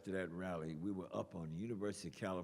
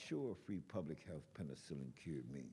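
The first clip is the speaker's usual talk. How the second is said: poem recital; 4 semitones lower than usual. Acted. Poet refuses Uncle Sammy's war, reciting defiantly. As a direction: lower aside growing into firm defiance. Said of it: "So I went on to recite this poem".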